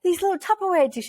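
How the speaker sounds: high, eager show-and-tell; pretend excitement. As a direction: present it like a thrilling surprise. Re-enacts showing off the purchase.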